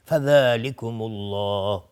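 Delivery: slow, drawn-out melodic Quranic chanting; Quran recitation. Shown as a demonstration. Tajwid teacher reciting a verse for students, slow chant. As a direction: chant the verse with long held vowels. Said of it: "تمام این کارا از خداوند ساخته است".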